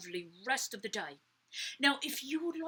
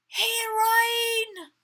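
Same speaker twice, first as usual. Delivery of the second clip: high, loud, drawn-out call; children crying out; 12 semitones higher, 16 dB louder than usual. A narrator's reading. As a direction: call it out like an excited class. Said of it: "they cried out".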